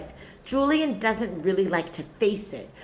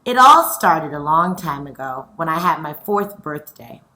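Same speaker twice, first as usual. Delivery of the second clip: louder, lively storytelling voice; story reading to children; 11 dB louder than usual. A narrator's reading. Teacher reading chapter four to her scholars, lively. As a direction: read it out like a picture book for kids. Reads the story aloud to class.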